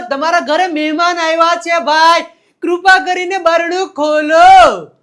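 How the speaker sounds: high, loud shout building to a long holler; calling at the door. Acted. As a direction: holler to be let in, stretching the last word. Acts guest calling at the door. (બારણે ઊભા રહી મોટેથી બૂમ)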